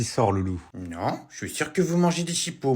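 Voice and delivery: ordinary delivery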